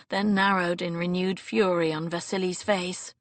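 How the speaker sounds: ordinary delivery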